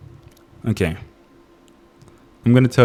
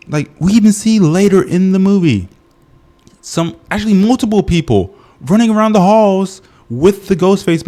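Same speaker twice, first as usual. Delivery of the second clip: excited rant; 6 semitones higher than usual. Real, not acted. Heated rant about school safety.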